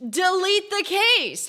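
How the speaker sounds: high, loud, slow order; exasperated demand. Real, not acted. Player fed up with another creepy case, demanding loudly. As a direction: pitch it up and stretch each word.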